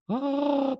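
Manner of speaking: high, sustained, held "oh"; awestruck "oh". Retold or imitated. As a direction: hold a high note of reverent awe. Imitates awe at superstar celebrity.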